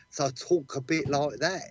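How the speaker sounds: childhood Cockney, put on; Cockney accent. Shown as a demonstration. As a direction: talk like a little London Cockney kid. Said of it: "I had a Cockney accent".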